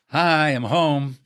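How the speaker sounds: slow, drawn-out cheery greeting; a husband calling out as he comes home, slowly. Acted. Husband voiced arriving home.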